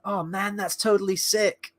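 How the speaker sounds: mock the blue aliens like a stoner surfer; surfer dude. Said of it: "really just surfer dude kind of talk".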